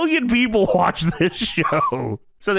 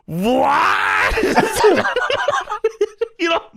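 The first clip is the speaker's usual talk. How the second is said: incredulous; 10 semitones higher, much slower than usual. Real, not acted. Incredulous outburst.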